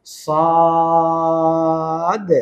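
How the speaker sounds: "সদ" with a thick s and long level vowel, bouncing off the d; very slow emphatic Arabic "সদ"; heavy, stretched letter. Shown as a demonstration. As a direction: hold "সদ" long and full, then release the final d. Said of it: "মোটা করব এবং শেষে একটা ধাক্কা দিব".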